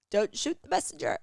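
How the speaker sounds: high, playful put-on voice; mock defensive. Podcaster to listeners, teasing in a high mock voice. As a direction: duck the blame with a silly voice. Podcaster jokingly hedges a blunt opinion.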